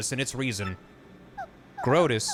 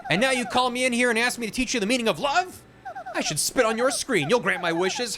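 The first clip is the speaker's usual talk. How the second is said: much higher, indignant outburst; angry princess; 9 semitones higher than usual. Acted. Peach scolding her kidnapper computer, high indignant rant. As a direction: tell him off with little angry fists.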